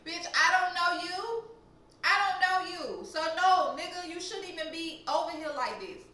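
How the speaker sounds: loud, high-pitched protest; indignant telling-off. Retold or imitated. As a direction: insist loudly, worked up at an intruder. Re-enacts telling stranger off.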